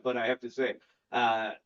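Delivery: normal talking voice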